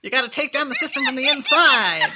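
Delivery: hammy cartoon crocodile voice. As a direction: play the crocodile as a rabble-rouser. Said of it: "I could do the crocodile as like".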